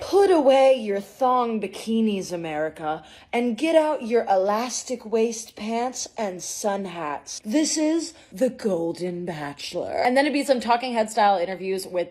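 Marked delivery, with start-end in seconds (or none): 0.0-9.9 slow, loud TV promo voice; measured, booming announcer read; promo narrator. Acted. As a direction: announce it like a network dating-show trailer. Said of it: "the narrator would be like".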